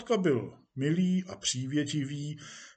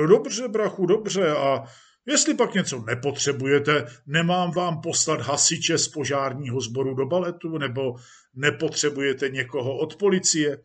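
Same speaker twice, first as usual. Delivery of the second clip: jovial police chief; 4 dB louder than usual. Acted. Police chief offers actor firemen, jovially. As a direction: offer favours grandly to an old pal.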